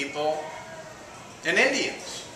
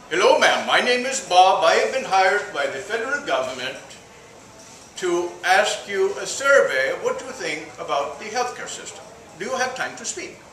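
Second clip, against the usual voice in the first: Indian caller, higher, lilting and polite; Indian accent; 4 semitones higher than usual. Acted. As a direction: put on an Indian accent for a survey call. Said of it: "The Indian calls the old woman".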